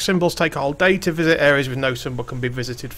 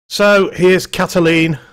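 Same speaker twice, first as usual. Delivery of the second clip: grand announcement; 3 semitones higher, 5 dB louder, slower than usual. Acted. Grand slow introduction of character.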